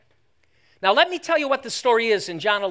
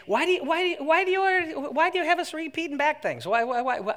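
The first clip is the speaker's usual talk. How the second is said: high, stammering mock complaint; complaining listener; 8 semitones higher than usual. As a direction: whine the question in a put-on high voice. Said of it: "Now, some would say".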